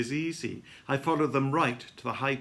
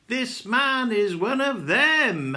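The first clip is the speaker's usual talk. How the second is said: loud, high, slow venomous denouncement; spiteful raised voice, drawn out; venomous accusation; 10 semitones higher, 7 dB louder, slower than usual. A narrator's reading. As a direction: denounce him loudly, with venom in every word. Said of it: "There is such venom in her voice".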